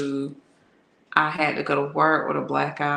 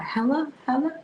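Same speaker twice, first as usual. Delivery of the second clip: higher, calling hello down the line; girl on the phone; 11 semitones higher, faster than usual. Retold or imitated. Girl on his phone answering, calling hello. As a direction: imitate a woman calling out on the phone. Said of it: "And she was like".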